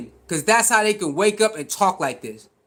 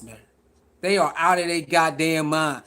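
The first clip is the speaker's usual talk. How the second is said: ordinary talk, nothing marked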